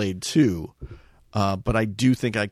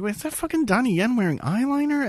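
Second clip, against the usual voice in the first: disbelief; 12 semitones higher, faster than usual. Retold or imitated. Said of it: "I'm like".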